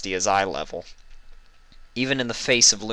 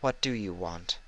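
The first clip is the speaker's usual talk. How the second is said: gentle question; 8 dB quieter than usual. A narrator's reading. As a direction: coax the answer out softly. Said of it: "Celestia's gentle voice".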